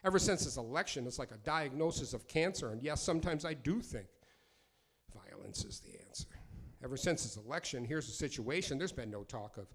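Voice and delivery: rhythmic spoken word delivery; performing a dating poem live. Acted. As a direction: ride the rhymes like a performance poet.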